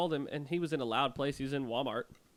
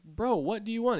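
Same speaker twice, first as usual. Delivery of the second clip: higher-pitched retold demand; exasperated calling out; 8 semitones higher, 4 dB louder than usual. Showering man to brother's friend at the door, exasperated call. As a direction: call out as if fed up with a prank.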